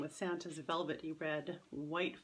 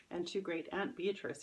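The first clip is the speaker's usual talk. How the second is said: plain reading voice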